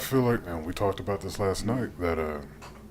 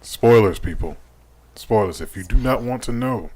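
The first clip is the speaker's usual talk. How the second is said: much louder spoiler warning; 3 semitones higher, 9 dB louder than usual. Real, not acted. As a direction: call out the warning to listeners. Warning listeners about spoilers.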